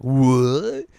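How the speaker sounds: loud, rising mock shock; startled what. Imitating the Flower Prince seeing him wake, mock startled. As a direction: blurt it in hammed-up disbelief. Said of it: "the Flower Prince is like".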